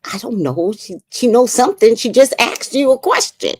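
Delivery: light teasing voice, climbing up; playful mock defense. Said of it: "he goes".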